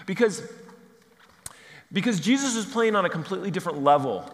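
ordinary talk

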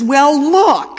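loud, high, laughing call; laughing shout. Retold or imitated. Colleague laughing, calling out.

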